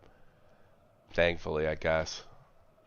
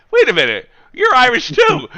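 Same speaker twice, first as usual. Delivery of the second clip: much louder, high-pitched protest; mock indignation; 14 semitones higher, 10 dB louder than usual. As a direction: cry out in comic outraged disbelief. Acting out retort to Irish insult.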